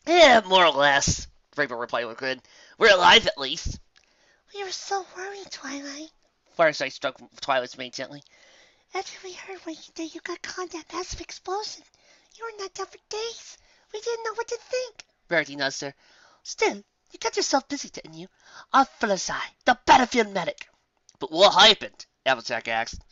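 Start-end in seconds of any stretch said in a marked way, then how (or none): 0.1-3.8 brash tomboy. A narrator's reading. Rainbow voiced. 4.5-15.0 high, breathy, gentle whisper; shy and tender. A narrator's reading. Fluttershy voiced. 16.4-20.7 high, refined teasing; playful lady; posh lady voice. A narrator's reading. Rarity voiced.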